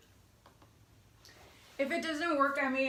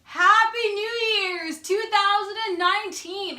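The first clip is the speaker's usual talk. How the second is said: excited cheer; 7 semitones higher, 8 dB louder than usual. Real, not acted. New year cheer.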